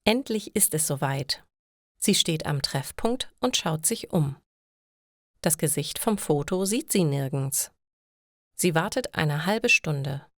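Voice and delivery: usual speaking voice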